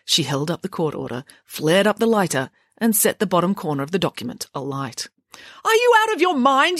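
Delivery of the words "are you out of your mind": very high, loud outburst; shrieking-high incredulous headmaster; alarmed yell. A narrator's reading. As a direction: shout in appalled disbelief. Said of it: "yelled the headmaster".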